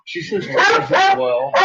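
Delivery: high playful dog talk; high pet voice. Real, not acted. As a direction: play up the Lassie bit. Lassie game with dog.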